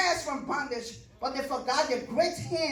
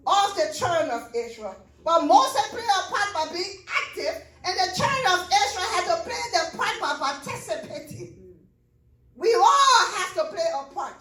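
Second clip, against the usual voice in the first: preaching at full force; 5 semitones higher, 3 dB louder than usual. Acted.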